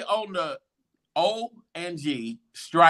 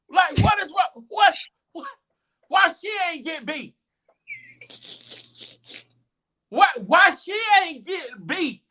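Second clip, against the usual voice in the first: outraged; 12 semitones higher than usual. Retold or imitated. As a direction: complain at the top of the voice. Outraged protest, high voice.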